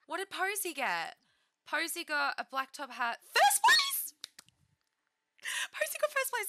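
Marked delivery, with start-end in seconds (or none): 3.3-6.5 high, loud, airy squeal; breathless victory squeal; excited. Real, not acted. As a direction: burst out in real triumph. Sim wins competition.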